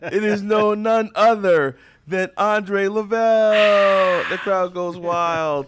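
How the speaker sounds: slow, booming arena announcer introduction; NBA announcer voice. Acted. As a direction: announce him like a star player at tip-off. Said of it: "I got my N B A announcer, uh, voice going on".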